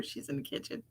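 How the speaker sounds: barely audible breathy aside; whisper. Real, not acted. Telling viewers where the spirit is, whispered. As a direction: drop to pure breath.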